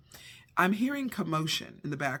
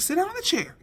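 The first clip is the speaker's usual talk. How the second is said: firm high command; 11 semitones higher, faster than usual. Retold or imitated. Mother orders confused son into the chair, high and firm. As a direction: order him to sit, firmly.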